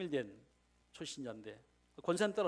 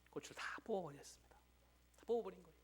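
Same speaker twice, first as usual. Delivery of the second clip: whispered like a secret; conspiratorial sermon whisper; hushed whisper; 4 semitones higher, 5 dB quieter than usual. Retold or imitated. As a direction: lean in and whisper it. Secret deed told in hush.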